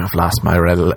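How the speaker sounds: usual speaking voice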